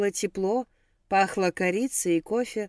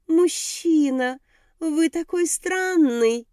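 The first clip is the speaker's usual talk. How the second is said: high, slow, mincing little voice; thin simpering voice; 8 semitones higher, much slower than usual. Acted. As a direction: coo it in a thin affected voice. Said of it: "тоненьким жеманным голоском". (тоненький жеманный голосок)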